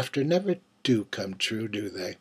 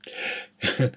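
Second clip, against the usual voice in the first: quiet breathy chuckle; a brief knowing laugh under the breath; soft chuckle; 4 dB quieter than usual. A narrator's reading. Narrator laughs at his own innuendo.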